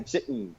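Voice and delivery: "chicken" soft, the K closed straight into the n; German-style swallowed K. Shown as a demonstration. Teacher confirming the learner's try, "chicken" with a swallowed K. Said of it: "that's how you say it in German".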